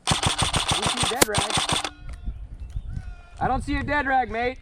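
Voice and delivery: loud, high call across the field; shouting. Real, not acted. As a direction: yell it out to be heard.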